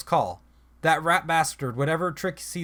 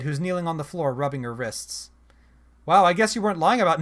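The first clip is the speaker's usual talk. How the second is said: ordinary delivery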